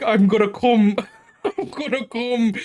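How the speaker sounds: high, drawn-out mock cry, laugh breaking in; mock moaning neighbour. Retelling what the upstairs neighbour yells all night, laughing mock cry. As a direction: mimic the noisy neighbour, half laughing.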